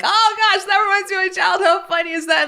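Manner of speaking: hammed-up gleeful yelp of a happy memory; delighted exclaim. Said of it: "my kid's memory is like".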